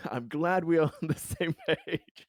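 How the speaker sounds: trying to keep a straight face and failing; laughing through the line. Real, not acted. Player breaking character in front of the President, laughing. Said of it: "trying to say this with a straight face".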